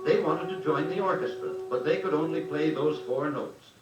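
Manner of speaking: usual speaking voice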